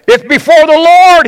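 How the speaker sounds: high, loud indignant retort; defensive. Acted. Husband answering his wife's mockery, loudly defensive. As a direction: fire it back with the pitch jumping up. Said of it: "he immediately is defensive".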